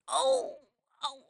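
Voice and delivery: high-pitched yelp of pain; imitated pained "ow". Patient as unexpected sticker is ripped off, pained yelp. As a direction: yelp as if caught off guard.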